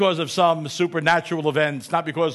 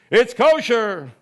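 slow, higher and louder heavenly proclamation; 8 semitones higher, 9 dB louder, much slower than usual. Retold or imitated. A voice from heaven answering the rabbis, slow and booming. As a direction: boom it down slowly from on high. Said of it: "a voice comes down from heaven saying".

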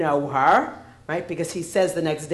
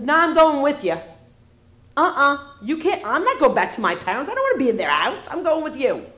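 put-on higher and louder, whiny kid; bratty little kid; 7 semitones higher, 5 dB louder, much faster than usual. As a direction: plead like a stubborn child. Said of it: "this pyoiky little kid says".

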